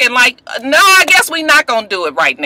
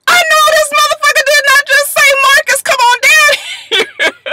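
stunned disbelief. Retold or imitated. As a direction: drag it out like you can't believe it. Her stunned inner reaction.

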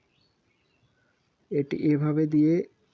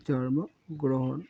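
slow dictation; 3 dB quieter, much slower than usual. Shown as a demonstration. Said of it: "teacher dictates word".

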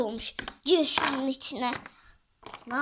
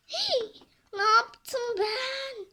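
high gasp, slow dismayed question; gasp, then drag out the dismay; a mock-horrified gasp; shocked gasp; 11 semitones higher, 4 dB louder, much slower than usual. Gasp at own mistake.

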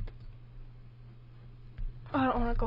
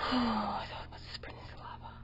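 whisper; 6 dB quieter, faster than usual. Real, not acted. Sneaking past lava whispering.

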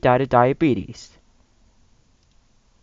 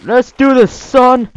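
dad voice; 9 semitones higher, 7 dB louder than usual. Acted.

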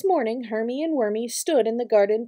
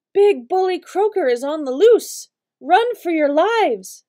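alarmed shouting; 6 semitones higher, 5 dB louder than usual. Acted. Bugs warn of the loose frog.

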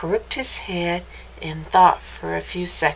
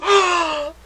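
high audible gasp; shocked gasp; 8 semitones higher than usual. Acted. Reader seeing the changed dog, shocked gasp. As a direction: gasp in mock horror.

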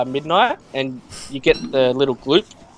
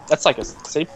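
usual speaking voice

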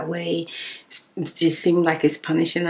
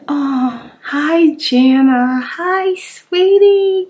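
excited greeting; 7 semitones higher, 7 dB louder, much slower than usual. Real, not acted.

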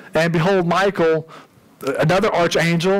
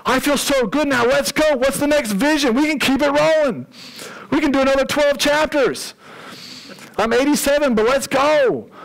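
much higher, bursting with eager energy; eager excitement; 11 semitones higher than usual. Acted. Preacher voicing Daniel to congregation, eager and excited. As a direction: pump it up like a revived old man ready to go.

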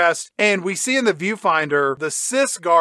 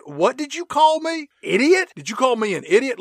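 higher-pitched affronted challenge; mock offended; 7 semitones higher than usual. Gribble turns on Milo over the insult, mock offended. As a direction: act indignant at being insulted.

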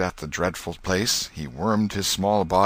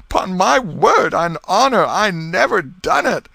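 higher, louder, slow pleading sob; sobbing plea; 13 semitones higher, 9 dB louder than usual. Acted. Potter, in tears before the dead man, begs his friends, sobbing. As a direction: beg the crowd through tears. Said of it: "burst into tears".

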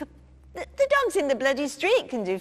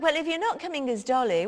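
normal talking voice

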